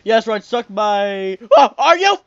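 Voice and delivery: loud, rising to a high shriek; excited yell. Real, not acted. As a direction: shout at the screen, building to a squeal. Loud excited yelling at game.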